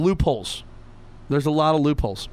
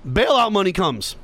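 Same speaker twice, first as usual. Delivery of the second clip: ordinary delivery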